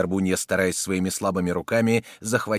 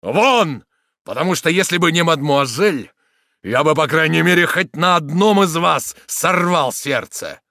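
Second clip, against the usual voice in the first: loud, raised threat, slowing at the end; agitated fury; 7 semitones higher, 8 dB louder than usual. A narrator's reading. Soldier threatening the villain, furious shouting. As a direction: order him out furiously. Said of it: "возбуждение и беспокойство которого увеличились".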